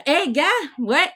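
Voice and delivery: normal talking voice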